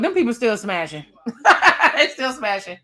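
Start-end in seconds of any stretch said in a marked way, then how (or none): 1.2-1.9 a burst of high loud laughter. Real, not acted. Laughing loud while recapping the reunion drama.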